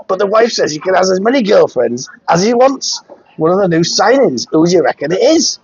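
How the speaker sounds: put-on a light Geordie-style Sunderland accent. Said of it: "attempt to do a Sunderland accent".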